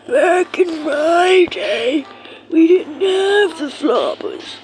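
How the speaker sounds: a hammy grandpa reminiscing, slowly. Pretend. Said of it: "how much y'all rate my, um, grandpa voice".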